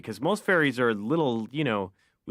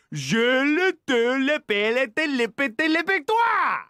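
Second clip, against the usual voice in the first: way higher, louder rattled-off fake French; a hammed-up frantic Frenchman; mock French gibberish; 14 semitones higher, 5 dB louder than usual. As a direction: fire off the fake French syllables in a shrieking frenzy. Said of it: "he goes".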